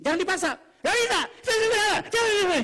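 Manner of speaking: high, fast, loud mock outburst; hysterical refusal. Spouse refusing to continue arguing, hysterical shouting. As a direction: shriek your refusal in a rapid burst.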